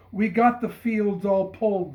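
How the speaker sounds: loud, high cheering call; joyful cry. Acted. Neighbors cheering to the bishop from their passing wagons. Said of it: "they cried".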